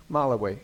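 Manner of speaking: Malawi said MAH-luh-wee, stress up front; unsure pronunciation. Real, not acted. Moderator reading a question, "Malawi" mispronounced as MAH-luh-wee. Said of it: "Am I pronouncing that right?".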